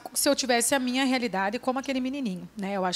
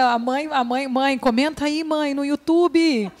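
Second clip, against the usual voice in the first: put-on child calling out; 4 semitones higher, 7 dB louder, slower than usual. Filha chamando a mãe.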